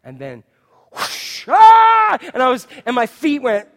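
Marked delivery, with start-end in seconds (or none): 0.9-1.5 breath-only whoosh; rushing air sound effect; whooshing ride noise. Retold or imitated. 1.5-2.2 loud, high acted scream; hammed-up shriek of terror; terrified ride scream. Reenacts own screaming on ride.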